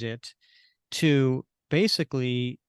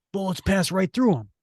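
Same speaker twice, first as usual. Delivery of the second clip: alarmed; 6 semitones higher than usual. Acted. Comic character's alarmed cry.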